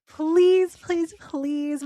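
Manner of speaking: playful begging please; pleading. Real, not acted. Begging listeners for reviews.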